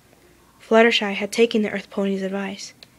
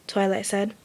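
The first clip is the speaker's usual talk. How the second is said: ordinary delivery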